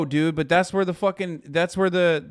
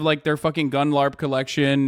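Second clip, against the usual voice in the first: usual speaking voice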